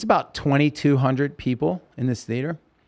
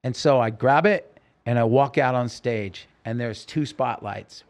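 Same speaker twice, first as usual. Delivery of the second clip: ordinary talk, nothing marked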